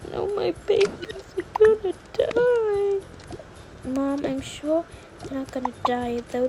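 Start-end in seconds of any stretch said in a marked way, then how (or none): 0.1-3.0 despairing wail. Acted. Mother fearing for twins.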